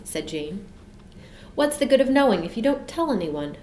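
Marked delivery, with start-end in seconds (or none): 1.5-3.6 grumbled. A narrator's reading. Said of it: "grumbled Michael".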